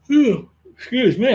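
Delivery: a yawn spilling into the words. Real, not acted. Livestream host yawns mid-sentence, yawning through it. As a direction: let a real yawn carry phew. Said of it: "I'm yawning".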